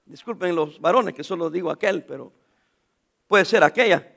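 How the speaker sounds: ordinary delivery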